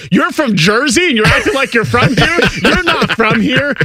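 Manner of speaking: hammed-up sneering at the Jersey girls; mock put-down of Jersey girls; snobby New Yorker. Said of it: "criticizing them".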